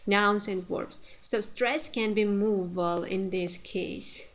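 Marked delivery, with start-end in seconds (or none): none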